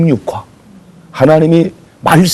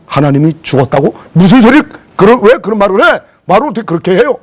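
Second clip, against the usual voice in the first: shocked protest; 5 semitones higher than usual. Acted. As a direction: recoil at an outrageous claim. Luther shocked at wife.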